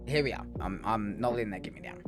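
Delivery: ordinary talk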